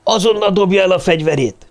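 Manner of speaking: high, urgent command; shouted order. A narrator's reading. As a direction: order an armed man to disarm. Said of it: "ordította Tony Cartwright".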